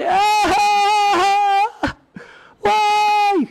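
long, high imitated wail; mock crying. Imitating the rabbi crying at midnight, high drawn-out wail. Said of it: "בוכה".

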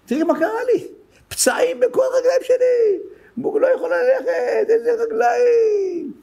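high, wailing mock lament of a sick woman; crying complaint. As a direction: imitate her crying out about her sore legs. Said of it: "בכי". (קינה בוכייה ומחוקה בקול גבוה)

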